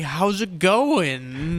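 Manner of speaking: very slow, drawn-out dopey greeting; dopey, dragging casual hello. Acted. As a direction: stretch it out like a meandering goof. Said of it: "dopey, and we're just like".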